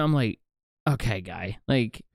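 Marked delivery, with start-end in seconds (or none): 0.9-1.6 dismissive. Retold or imitated. Retold dismissive reply to eager stranger.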